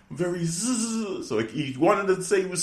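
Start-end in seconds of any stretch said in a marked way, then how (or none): 0.1-1.3 slow, higher, softer mumble into nothing; mumbled trailing off. Retold or imitated. Host mimicking Jericho failing to apologize, mumbling. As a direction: let the word dissolve.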